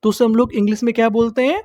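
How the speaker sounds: normal talking voice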